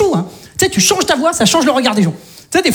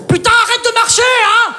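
much higher exasperated shout; mock yell; 12 semitones higher than usual. Annoyed at the neighbour upstairs, high shout. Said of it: "Le mec, il fait rien".

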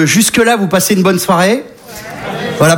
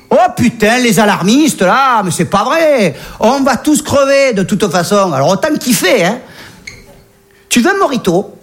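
put-on Marseille accent; 2 semitones higher than usual. Polar bear with a Marseille accent offering the journalist a mojito. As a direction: shrug off the doom in a thick Marseille accent. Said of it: "l'accent marseillais".

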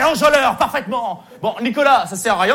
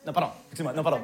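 sheepish backtrack; 5 semitones lower, 8 dB quieter, much faster than usual. Acted. Backtracks after outburst.

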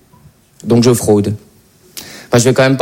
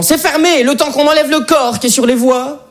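high-pitched officer turning someone away; policeman; 9 semitones higher than usual. Acted. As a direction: block the way as an exasperated metro cop. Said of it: "un policier qui m'en a empêché en me disant". (policier qui barre l'accès, voix haut perchée)

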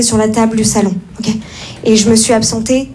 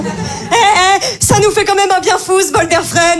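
cheery aunt; 5 semitones higher, slower than usual. Retold or imitated. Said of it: "aunt's cheerful walk voice".

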